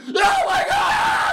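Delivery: high, drawn-out mock scream; whooping, full-volume "oh my God"; screamed group reaction. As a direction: shriek it long and loud. Everyone screaming in the retold prank.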